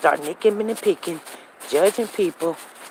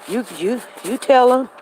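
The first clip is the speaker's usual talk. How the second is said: ordinary talk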